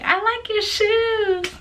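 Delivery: high, slow, drawn-out sing-song; high, slow mock-flirty "I like your shoes"; mock exaggerated version. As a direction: overdo the compliment in a high, stretched voice. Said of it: "I didn't say it like that".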